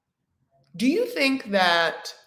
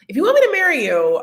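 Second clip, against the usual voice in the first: high, loud and fast, hammering the word me; emphatic high repeat; 10 semitones higher, 8 dB louder, faster than usual. Shown as a demonstration. Repeating the advice to viewers, now high and loud. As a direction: jump up and shout it fast for emphasis. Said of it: "repeats the line louder".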